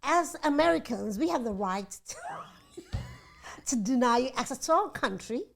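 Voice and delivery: American accent, half laughing. Shown as a demonstration. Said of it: "Am I saying it in an American accent?".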